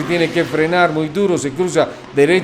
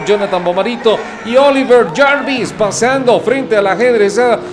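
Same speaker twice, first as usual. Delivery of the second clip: excited shout; 6 semitones higher, 3 dB louder than usual. Real, not acted. Winner crossing the line.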